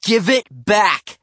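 loud, slow, high-pitched order; firm demand. A narrator's reading. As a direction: demand it angrily, word by word. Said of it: "I demanded".

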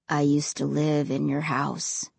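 low, heavy ghost voice; quiet regret, low and cold; deep and cold. A narrator's reading. Ray's voice.

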